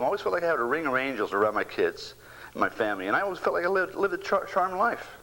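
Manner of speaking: normal talking voice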